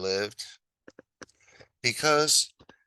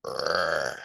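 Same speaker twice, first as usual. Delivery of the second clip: ox growl; 4 semitones lower than usual. Acted. Ox growling in story.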